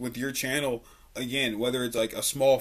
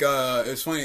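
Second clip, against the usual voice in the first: usual speaking voice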